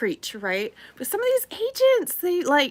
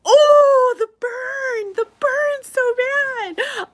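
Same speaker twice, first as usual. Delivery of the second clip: mock wail, much higher and louder, slow on the burn; mock wounded wail; 8 dB louder, much slower than usual. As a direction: ham up being stung by the joke. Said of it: "I was like".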